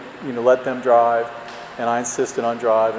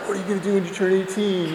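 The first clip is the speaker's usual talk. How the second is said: friends asking; 6 semitones higher, 5 dB quieter, faster than usual. Retold or imitated. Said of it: "all my friends like".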